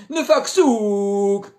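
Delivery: slow, shouted name backwards; backwards speech. Acted. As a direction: drag it out in reverse. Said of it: "rückwärts".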